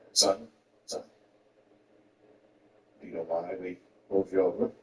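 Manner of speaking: very low, stern cop voice; deep, deliberate roadside questioning; police officer. Acted. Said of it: "If police pull me over".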